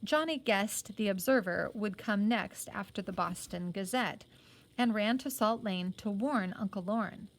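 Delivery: ordinary delivery, nothing marked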